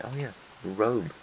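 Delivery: usual speaking voice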